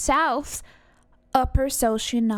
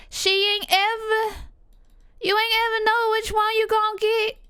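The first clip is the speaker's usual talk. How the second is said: Southern film quote; 11 semitones higher than usual. Acted. Finishing mama's saying, high Southern accent impression. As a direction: hold a high, put-on Southern voice.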